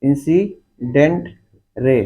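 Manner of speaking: Incident रे very slowly, broken into separate beats; teacher's dictation of incident रे, slow and chopped into syllables. Shown as a demonstration. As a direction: spell out incident रे by sound, pausing between the parts. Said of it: "उसको बोलते हैं incident रे".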